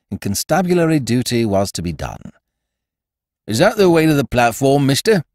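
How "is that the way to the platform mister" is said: constable's voice. A narrator's reading. Gruff constable questioning narrator.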